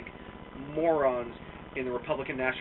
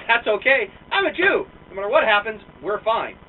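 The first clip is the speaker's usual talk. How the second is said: cheerful reassurance; 5 semitones higher, 9 dB louder than usual. Retold or imitated. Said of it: "I said".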